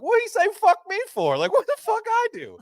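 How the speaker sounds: high, loud mock rapper squeal. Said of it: "was like that [name] gif of".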